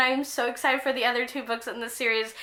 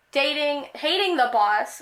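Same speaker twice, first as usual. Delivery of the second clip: ordinary talk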